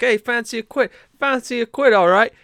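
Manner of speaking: lightly voiced drunk Brit begging a quid; drunk English hooligan. On a London street, hooligan pesters the boys in a mock English drunk voice. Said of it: "He's like".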